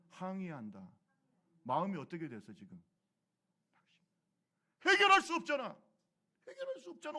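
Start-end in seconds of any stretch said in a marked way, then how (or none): 4.8-7.2 loud, high cry of helplessness; despairing cry. Acted. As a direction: cry out that there is no way. Voices despair before the sea.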